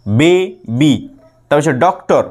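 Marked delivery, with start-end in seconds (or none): 0.1-0.9 baby split into বে, pause, বি; syllable break. Shown as a demonstration. Teacher counts two syllables, baby broken after বে. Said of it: "দুটো".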